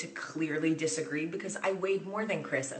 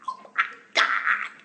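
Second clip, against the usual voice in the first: whispered freak-out; 8 dB louder, slower than usual. Retold or imitated. Star right behind her.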